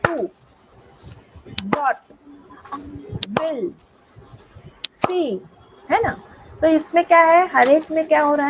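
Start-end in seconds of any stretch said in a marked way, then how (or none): 0.0-5.4 टू, डॉट, विल, सी as single beats with long gaps; one isolated beat per word: टू ... डॉट ... विल ... सी; spaced-out demo of टू, डॉट, विल, सी, each in one go; one clap each. Shown as a demonstration. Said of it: "एक ही इसमें clap में होते हैं".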